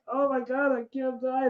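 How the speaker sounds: imitating a high, frantic plea; mock terror, as if he's dying from the sauce; acted panic. Acting himself at the doctor.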